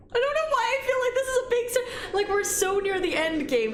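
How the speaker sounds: high-pitched anxious babble; nervous squeak. Real, not acted. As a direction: fret in a very high voice. Nervous near the game's end.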